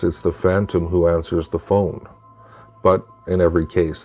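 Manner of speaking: ordinary delivery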